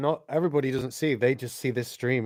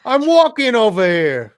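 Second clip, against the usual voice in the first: playful New York cabbie voice; mock Brooklyn tough guy; New York accent; 10 semitones higher, 9 dB louder, slower than usual. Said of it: "talk in Brooklyn accents".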